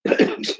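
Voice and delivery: dry cough. Real, not acted. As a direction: clear the throat with a cough. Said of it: "I have to cough".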